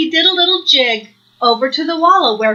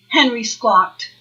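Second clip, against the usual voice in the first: ordinary delivery, nothing marked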